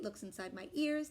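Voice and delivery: ordinary delivery, nothing marked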